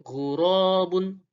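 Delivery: ghurabun with a throaty gh; Arabic ghain. Shown as a demonstration. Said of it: "huruf ghain".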